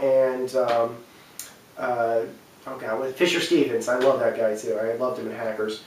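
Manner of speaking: ordinary delivery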